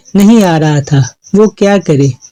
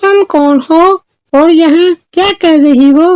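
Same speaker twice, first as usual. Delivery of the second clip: put-on high-pitched girl character; questioning Chanchal; 10 semitones higher than usual. Trapped girl asks the fairy who she is, high questioning voice. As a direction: ask in a raised storybook voice. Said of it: "फिर चंचल ने पूछा".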